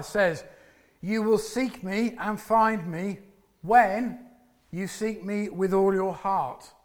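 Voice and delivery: normal talking voice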